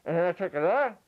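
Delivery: chewing while talking; mouth full. Shown as a demonstration. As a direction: talk around a bite. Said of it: "ventriloquism while you're eating".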